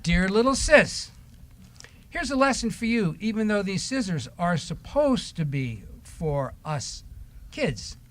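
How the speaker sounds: ordinary talk, nothing marked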